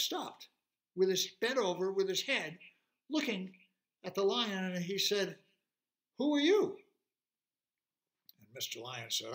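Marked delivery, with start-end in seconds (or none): 6.2-6.8 high, loud dinosaur demand; startled T-Rex challenge. Acted. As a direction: challenge the lion, pitched up and loud. T-Rex challenges the roaring lion.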